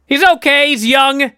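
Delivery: high, loud sing-song mockery of reassurance; mocking high voice. Host mocks the writing team's fake reassurance, high and sing-song. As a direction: mimic the writers with condescending cheer. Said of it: "writing team that have been telling me".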